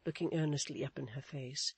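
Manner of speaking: usual speaking voice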